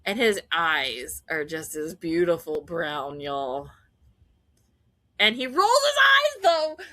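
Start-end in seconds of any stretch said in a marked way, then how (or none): 5.2-6.8 much higher and louder, delighted; excited burst. Real, not acted. Fan thrilled by Darcy rolling his eyes, excited and loud. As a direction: blurt it out with glee. Excited retelling of the eye roll.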